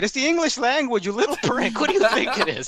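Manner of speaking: exasperated mock scolding; mock exasperated rant. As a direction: scold the little prick. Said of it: "they're like".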